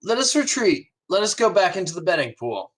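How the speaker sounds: rally him off to the casino; cheerful first mate. Acted. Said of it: "and he goes".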